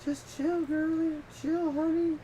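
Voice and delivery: high put-on voice telling her to chill; Nephi. Friend mimicking a husband calming his wife, mock chill. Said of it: "Nephi's all like".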